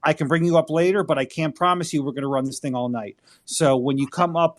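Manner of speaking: ordinary talk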